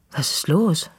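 anxious question at the door; alarmed, asking what happened; worried. A narrator's reading. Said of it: "fragt sie besorgt".

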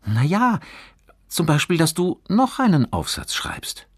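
put-on high, lively boy's voice; eager explaining boy. A narrator's reading. Johannes explains the second chance, eagerly. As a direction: explain the idea helpfully.

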